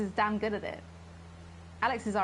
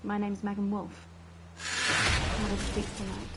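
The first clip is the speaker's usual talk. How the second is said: posh; slower than usual. Acted. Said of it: "this posh gobshite's voice".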